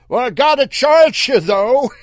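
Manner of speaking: loud, high, taunting call; mocking yell. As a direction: yell it with a grin. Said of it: "He laughed at that".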